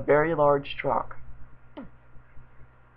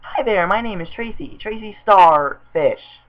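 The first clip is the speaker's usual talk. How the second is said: put-on drawn-out girly voice; 4 semitones higher, 5 dB louder, slower than usual. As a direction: stretch out Tracy Starfish. Voicing Tracy, drawn-out name.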